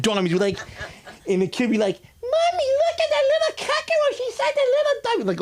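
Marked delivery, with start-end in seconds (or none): 2.2-5.3 put-on high squeaky child's voice; mock child voice much higher; kid voice. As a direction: play the little kid pointing, very high.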